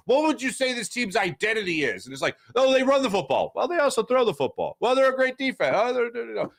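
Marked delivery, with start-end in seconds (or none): 2.6-6.5 mocking someone groping for an answer, pitched higher; mock flustered answerer. Podcaster mocking fans who can't name the team's identity, flustered and higher. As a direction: play a flustered fan who keeps changing the answer, voice raised.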